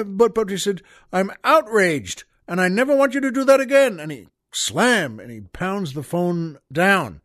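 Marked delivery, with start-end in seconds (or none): 1.1-4.0 higher and louder, rushing angry protest; a rabbi blowing up, fast and high; hammed-up fury, words tumbling out; outraged.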